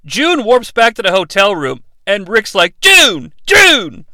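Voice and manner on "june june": high, loud call of a name. Retold or imitated. Rick calling for June in the hotel room, loud and high. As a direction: shout her name across the room.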